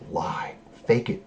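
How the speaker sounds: hushed conspiratorial aside; whispered. Retold or imitated. Mothers coaching the kids, whispered secret. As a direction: whisper it so no one hears. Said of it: "they're like".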